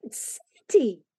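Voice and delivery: slow, drawn-out mock scream; a held-back squeal of discovery; excited scream. A narrator's reading. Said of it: "screamed Joel".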